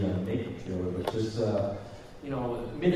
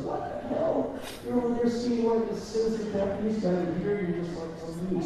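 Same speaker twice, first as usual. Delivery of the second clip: much higher, laughing incredulous tone; mock disbelief; 10 semitones higher than usual. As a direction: tease him like you can't believe it. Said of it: "He's just like".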